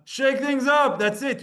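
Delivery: ordinary delivery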